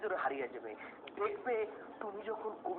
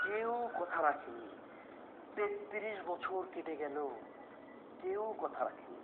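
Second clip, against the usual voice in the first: poem recital; 4 semitones lower, 3 dB quieter than usual. Shown as a demonstration. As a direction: recite it gently, with grief. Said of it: "বলো".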